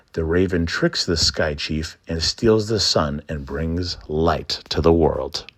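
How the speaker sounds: usual speaking voice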